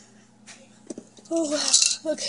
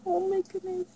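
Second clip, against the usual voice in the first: gasping, higher and softer; surprised gasp; 7 semitones higher, 4 dB quieter than usual. Real, not acted. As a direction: gasp then breathless awe. Opening a gift, gasping.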